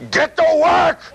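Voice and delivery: high, loud inner shout; booming command. Retold or imitated. Inner voice from the subway pushing you, shouted. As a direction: bark the order from behind. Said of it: "comes up behind you and goes".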